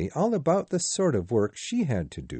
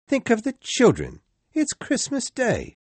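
higher, coaxing husband; pleading Bob; 14 semitones higher, 4 dB louder than usual. A narrator's reading. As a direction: gently remind her what day it is. Bob calms his wife.

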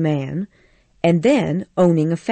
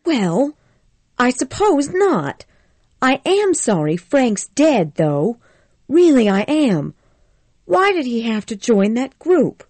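put-on higher, pleading young woman's voice; upset Scarlett; 7 semitones higher than usual. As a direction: Scarlett fretting guiltily to Rhett, higher. Scarlett answers, sorry.

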